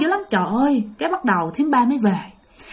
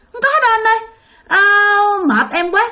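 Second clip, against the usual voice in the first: much higher, tired scoff; husband fed up with ghost talk; exasperated husband; 9 semitones higher than usual. Retold or imitated. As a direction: sigh it off as nonsense. Husband waves away wife.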